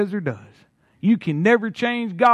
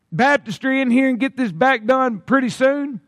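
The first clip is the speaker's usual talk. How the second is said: usual speaking voice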